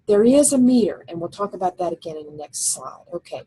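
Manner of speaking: ordinary talk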